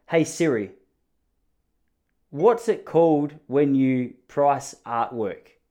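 slow, deliberate phone-assistant voice; talking to Siri. Real, not acted. Asking his phone's voice assistant, slow and careful. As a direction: spell the question out for the machine, slowly. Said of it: "Don't judge the way that I speak to Siri".

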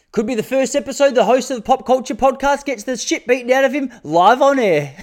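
high, giggling through the joke; laughing. Real, not acted. Host jokes about getting beaten up on air, laughing high. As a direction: crack yourself up mid-sentence.

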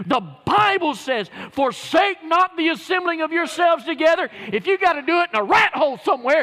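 loud, fired-up sermon; preaching at full force. Real, not acted. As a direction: preach it hard to the congregation.

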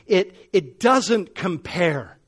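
preacher building to the climax of his testimony; preaching at full force. Acted. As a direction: drive it home with fire.

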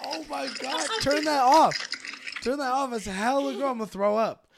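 high, loud grossed-out yelling; loud disgust. Real, not acted. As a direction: yell it, totally grossed out. Disgusted by eating video.

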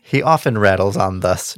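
ordinary delivery

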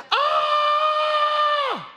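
high, loud mock wail. Preacher imitating professional mourners, high mock wail. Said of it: "they're professional mourners".

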